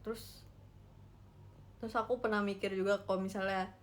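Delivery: ordinary talk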